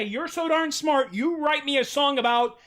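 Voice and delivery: mock fan. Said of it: "you can actually say".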